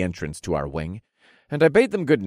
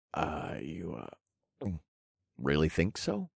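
soft, halting and hesitant; flustered; 3 semitones lower, 10 dB quieter than usual. Acted. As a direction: stammer it quietly, caught off guard. Flustered by her forwardness.

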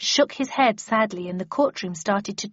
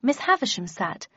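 ordinary delivery

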